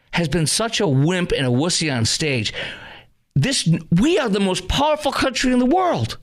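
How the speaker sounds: high, worked-up ranting; heated rant. Real, not acted. Commentator venting at the president to viewers, high and heated. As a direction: vent it, voice climbing.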